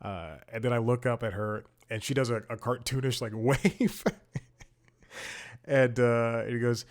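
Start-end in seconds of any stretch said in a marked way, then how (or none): none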